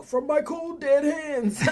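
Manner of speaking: high, slow, dramatic defiance; defiant mock declaration. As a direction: ham up a defiant last stand. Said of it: "are like, you know".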